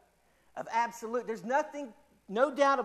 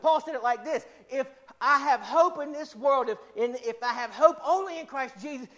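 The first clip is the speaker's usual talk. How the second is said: higher, louder preaching, building to a peak; full-force preaching; 4 semitones higher, 4 dB louder than usual. Acted. Preacher to the congregation, full-force preaching about hope. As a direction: drive the sermon home with rising fire.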